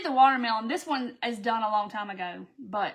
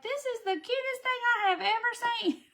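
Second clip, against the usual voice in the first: very high, squealing gush over the cutest gnome; gushing customer; 12 semitones higher than usual. Retold or imitated. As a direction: play a delighted shopper cooing. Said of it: "people will like".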